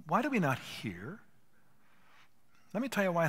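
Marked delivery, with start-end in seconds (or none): none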